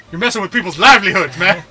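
loud, high protest cracking into laughter; mock outrage. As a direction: protest loudly, half laughing. Acted rant breaking into laughter.

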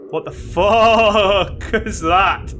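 drawn-out, slow swearing breaking into laughter; startled. Real, not acted. Player startled in the creepy house, shocked and laughing. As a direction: stretch the swear word long, then laugh.

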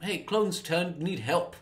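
lightly voiced breezy Obi-Wan; casual. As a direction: toss it off like it's nothing. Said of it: "he just says it so casually".

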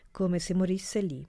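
ordinary delivery

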